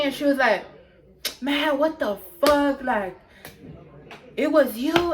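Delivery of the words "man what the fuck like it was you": higher-pitched stunned questioning; imitated disbelief. Girl looking at the culprit, imitated disbelief. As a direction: act mildly floored, pitch lifted.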